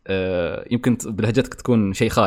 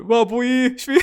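mock northern Emirati accent, high and playful; northern Gulf dialect; 12 semitones higher than usual. Teasing friends about the northern dialect, mock northern accent. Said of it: "نستهبل على لهجة أهل الشمال".

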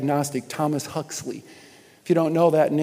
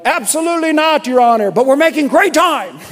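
high, loud Irish driver voice; cheerful driver; 9 semitones higher, 9 dB louder than usual. Retold or imitated. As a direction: answer the passenger brightly.

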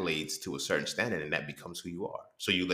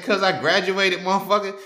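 proud comeback; 11 semitones higher, 9 dB louder than usual. Retold or imitated. Retold comeback to dad.

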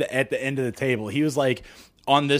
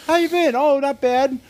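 high, quick sing-song pleasantries; a mock-cheerful brush-off chat; breezy small talk; 12 semitones higher than usual. Shown as a demonstration. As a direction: rattle off small talk chirpily. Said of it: "There's three options".

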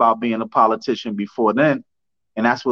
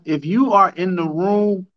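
normal talking voice

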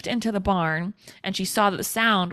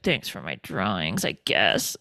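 much lower, grudging sarcastic thanks; mock deep Hannibal; 12 semitones lower than usual. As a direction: drop way down into a sulky Hannibal. Hannibal sulking over drawings.

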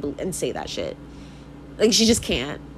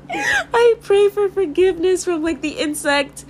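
tearful laughing plea; 10 semitones higher than usual. Real, not acted. Vlogger praying for a killed bug, high mock-tearful whimper.

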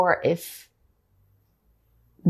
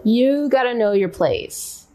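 mock the Southern bullies; Southern accent; 6 semitones higher than usual. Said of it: "in a Southern accent".

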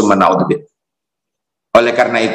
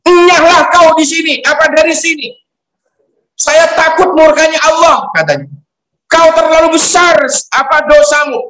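much higher, heated prophet driving the sinner out; angry Musa. Acted. Prophet Musa angrily drives away the weeping sinner, much higher heated voice. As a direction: hurl him from the door in a fury. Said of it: "saking marahnya Nabi Musa".

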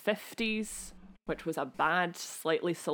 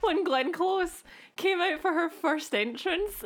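high-pitched, laughing through the words; giggly excitement; 10 semitones higher than usual. Real, not acted. Fan recalling the star's first entrance, giggling. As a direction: giggle your way through the memory. Giggling over fangirl memory.

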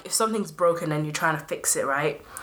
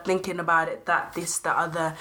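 ordinary delivery

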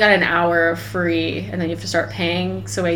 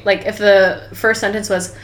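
usual speaking voice

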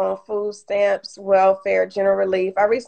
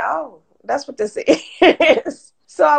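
rueful laugh; 6 semitones higher, slower than usual. Retold or imitated. Retold realization of firing.

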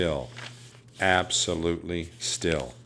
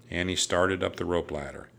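ordinary delivery, nothing marked

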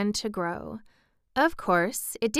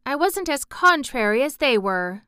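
put-on higher, louder girlish indignation; mildly spiteful; 6 semitones higher, 5 dB louder than usual. A narrator's reading. Mary defending herself against teasing, mildly spiteful. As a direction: retort with a touch of spite. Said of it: "a rather spiteful dig".

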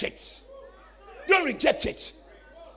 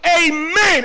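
shouted amen; 3 semitones higher, 7 dB louder than usual. Shown as a demonstration. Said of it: "you got to shout".

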